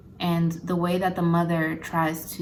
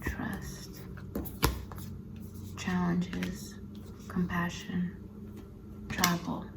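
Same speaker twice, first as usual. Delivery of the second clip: murmuring to oneself; 8 dB quieter, slower than usual. Real, not acted. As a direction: softly read off, word by word. Murmurs card names while drawing.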